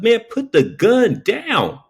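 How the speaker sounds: high, slow, deliberate order; firm slow command. Retold or imitated. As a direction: spell it out slowly and firmly. Firm repeated demand to robber.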